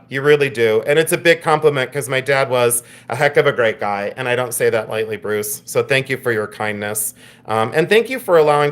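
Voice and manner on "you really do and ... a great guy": ordinary talk, nothing marked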